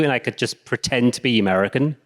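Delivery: usual speaking voice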